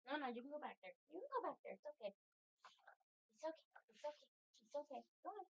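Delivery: high, soft pet talk. Real, not acted. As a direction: coo at the cat. Soothing a pet.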